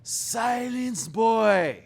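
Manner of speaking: mock thundering father, high and loud; stern command. Father silencing his complaining son, booming. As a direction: shout the boy into silence.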